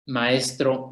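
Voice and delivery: normal talking voice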